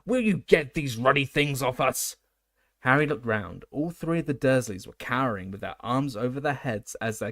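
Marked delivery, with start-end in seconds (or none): 0.1-2.2 a loud, angry uncle's shout. A narrator's reading. Uncle Vernon shouting at Dumbledore, loud and furious. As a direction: yell it at the old man in your living room. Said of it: "Uncle Vernon shouted".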